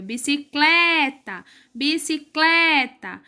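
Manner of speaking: "bicicleta" slowed, penultimate CLE drawn out; stress on CLE. Shown as a demonstration. Teacher proving the second-last syllable is strongest, stressed CLE in "bicicleta". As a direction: hold and push CLE in "bicicleta". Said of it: "A penúltima sílaba é a mais forte".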